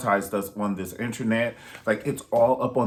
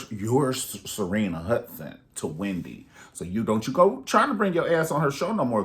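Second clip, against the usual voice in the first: ordinary talk, nothing marked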